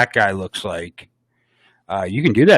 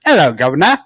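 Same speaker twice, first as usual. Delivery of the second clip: mock British accent, slightly higher; 6 semitones higher, 5 dB louder, faster than usual. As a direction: chirp it like a cheeky British gent. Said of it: "He gets a British accent".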